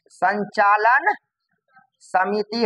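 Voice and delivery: very slow, drawn-out words; word by word for the class to copy; dictating. Shown as a demonstration. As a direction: stretch संचालन with a rise, then pause. Teacher dictates committee name.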